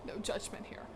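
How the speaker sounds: soft, unvoiced confiding whisper; whispered aside. Real, not acted. Vlogger reassures viewers about cheap stuff, whispered aside. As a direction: breathe it out like a secret.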